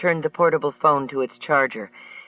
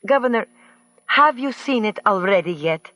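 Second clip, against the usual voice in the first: high, put-on foreign-accented woman; Earhart's voice; 7 semitones higher than usual. A narrator's reading. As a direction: voice her high and mannered over the phone. Narrator voices Earhart on phone.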